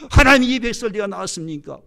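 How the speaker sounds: exasperated protest. Acted. Preacher re-enacting Moses' complaint to God, exasperated outcry. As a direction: cry out as if fed up, then let it fall.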